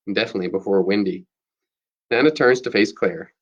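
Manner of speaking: ordinary delivery, nothing marked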